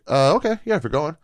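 hesitant high reply. Retold or imitated. Comic accepting a ride, said in a high hesitant mock voice.

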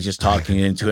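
normal talking voice

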